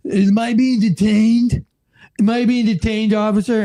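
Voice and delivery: mock drunk man. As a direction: play a drunk indignantly questioning an officer. Drunk man challenges the officer who woke him.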